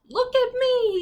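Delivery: high, loud call for attention; little raven calling out to be noticed. Acted. Crawford calling to birds that ignore him, high and loud.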